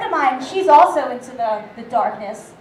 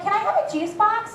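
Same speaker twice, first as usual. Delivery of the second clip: put-on child voice; 6 semitones higher than usual. Five-year-old asking.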